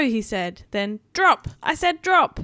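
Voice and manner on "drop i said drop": high, loud barked order; commanding shout. A narrator's reading. Buzz ordering the toys crossing the street, shouting. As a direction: yell the command at the team. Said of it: "Buzz called out directions".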